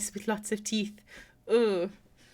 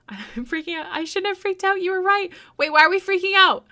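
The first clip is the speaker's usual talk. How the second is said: excited; 10 semitones higher, 8 dB louder than usual. Real, not acted. Excited reaction to art.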